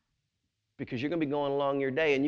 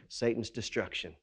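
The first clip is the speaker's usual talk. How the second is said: normal talking voice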